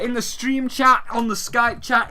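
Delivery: normal talking voice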